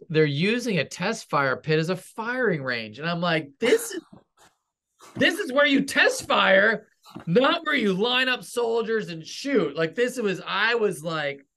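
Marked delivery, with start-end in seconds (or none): 3.6-9.8 irritated scolding. Retold or imitated. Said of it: "you're just sort of irritated".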